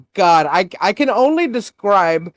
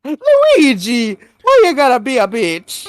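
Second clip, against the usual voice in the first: high, loud mock cartoon whine; Mario; 8 semitones higher, 5 dB louder than usual. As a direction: whine like a squeaky game mascot. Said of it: "while Mario is like".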